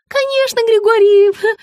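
high, breaking-off flatter; high fawning woman. A narrator's reading. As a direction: stop short on the name. Margarita cut off mid-name.

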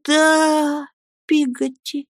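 put-on high, tearful boyish answer; stifled sob. A narrator's reading. Boy answering nanny while choking back sobs, high plaintive voice. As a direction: hold back tears as you answer. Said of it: "стараясь заглушить рыдание".

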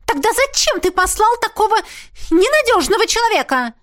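high, loud indignant shriek; shrill squeal. A narrator's reading. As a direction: squeal it like a spoiled princess. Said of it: "взвизгнула принцесса".

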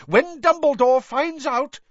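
high, loud threat, cut off; McGonagall's angry yell. A narrator's reading. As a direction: yell it as an outraged warning. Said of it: "yelled Professor McGonagall".

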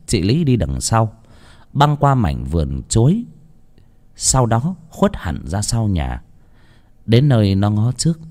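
plain reading voice

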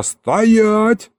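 high-pitched drawn-out halt order; yelled command. A narrator's reading. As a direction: call out sharply to stop them. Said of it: "заорал я". (окрик на высокой ноте)

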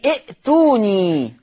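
ائتوني with a crisp glottal stop after the first vowel, then a long tuuu-nii; slow demo of ائتوني with the hamza sounded; hamza pronounced. Shown as a demonstration. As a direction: say ائتوني drawn out, catching the hamza. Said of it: "تقرأ إيتوني".

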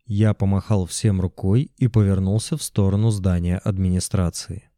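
ordinary delivery

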